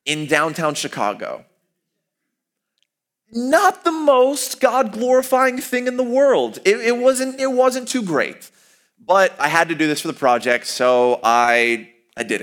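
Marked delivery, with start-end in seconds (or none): 3.3-8.5 high, drawn-out ironic understatement; wry, pitched-up mock understatement; sarcastic aside. Real, not acted. Telling the audience, sarcastic and pitched high.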